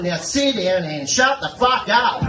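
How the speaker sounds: shouted, slow angry order; shouting. Acted. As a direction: bark it at the passenger. Angry cabbie telling off passenger.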